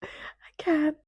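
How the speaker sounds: high, teary squeak; on the verge of real tears; overwhelmed. Real, not acted. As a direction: squeeze it out high, as if crying. Overwhelmed by the book, near tears.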